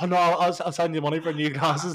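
high, laughing mock apology; Josh apologising. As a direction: play Josh guiltily offering money. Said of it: "He was like".

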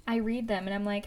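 ordinary talk, nothing marked

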